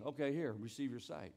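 Jesus speaking softly to the healed beggar; gentle Jesus. Retold or imitated. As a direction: hand him his sight gently.

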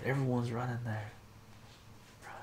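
ordinary delivery

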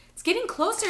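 very high, loud alarm; high-pitched urgent warning; frightened cry. A narrator's reading. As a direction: cry out in fear. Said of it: "Isabel cried".

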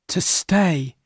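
put-on a shy girl unsure of her past; Bess voice. Bess chooses to stay.

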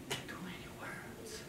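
hushed, confiding whisper; whispered punchline. Retold or imitated. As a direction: whisper it like a secret. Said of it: "And I said".

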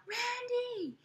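put-on very high squealy girls' voice; screaming fangirls.

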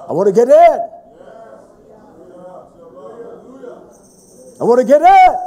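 loud preacher's cry; impassioned shout. Real, not acted. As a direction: belt it out from the pulpit. Preacher cries out his longing.

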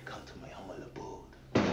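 hushed sinister welcome; creepy whisper. Acted. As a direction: whisper it like a villain luring someone in. Terrorist welcomes guest, whispering.

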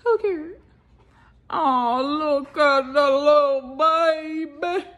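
slow, drawn-out coo in a changed voice. Real, not acted. Shown a little one by her daughter, cooing slowly.

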